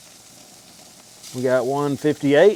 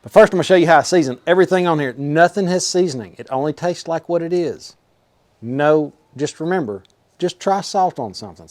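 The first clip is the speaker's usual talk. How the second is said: ordinary talk, nothing marked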